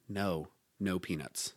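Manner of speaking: low, soft and slow, shaking off the peanuts; a hushed, deliberate no; mock secret agent refusing snacks; quiet refusal. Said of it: "slowly shake my head".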